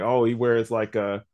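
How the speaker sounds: normal talking voice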